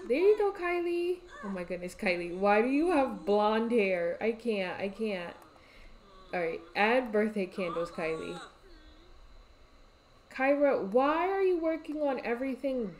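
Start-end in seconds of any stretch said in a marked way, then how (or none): none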